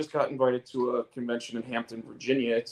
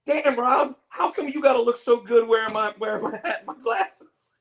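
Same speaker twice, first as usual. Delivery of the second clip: much higher mock-admiring voice with a laugh; imitating Terrence, very high; 13 semitones higher, 3 dB louder than usual. Friend admiring Rob in his hat, very high laughing voice. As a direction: squeal it in friend's voice. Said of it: "Terrence is like".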